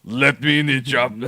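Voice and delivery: hammed-up accented action-star voice; Arnold Schwarzenegger. Hosts imagine him as Tony Montana, mock Schwarzenegger voice. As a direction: joke impression of an Austrian actor. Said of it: "he could play Tony Montana".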